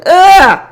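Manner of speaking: loud, high groan of disgust; exasperated disgusted grunt; disgusted ugh. Real, not acted. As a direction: let out a fed-up ugh. Disgust at Michelle.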